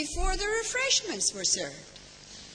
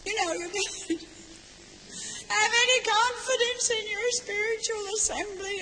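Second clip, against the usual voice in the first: high-pitched, tearful voice, mildly imitating a crying woman; tearful protest; 9 semitones higher than usual. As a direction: echo her upset protest through mock tears. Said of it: "She burst into tears and she said".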